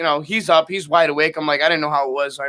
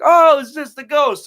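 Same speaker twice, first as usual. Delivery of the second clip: lightly voiced aunt; 9 semitones higher, 6 dB louder, much slower than usual. Aunt casually naming ghost.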